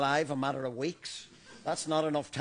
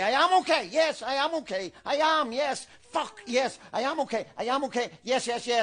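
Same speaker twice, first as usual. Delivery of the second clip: higher and louder, protesting too much; agitated denial; agitated insistence; 7 semitones higher, 4 dB louder than usual. Acted. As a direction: insist you're fine, clearly rattled. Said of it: "Dad, calm down".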